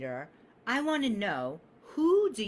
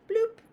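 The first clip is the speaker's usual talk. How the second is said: high, louder cartoon pop sound; squeaky mock sound effect; playful bloop; 5 dB louder than usual. Real, not acted. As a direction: pop it out like a toy noise. Showing off fancy markers.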